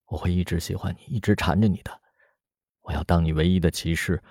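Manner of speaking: soft, hushed and earnest; tender confession. Acted. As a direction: confess love quietly, holding back nerves. Shy love confession.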